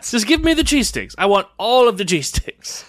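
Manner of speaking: high-pitched playful pleading; mock demanding. Craving cheese sticks and cutting off the chat, high mock demand. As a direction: beg greedily, hammed up.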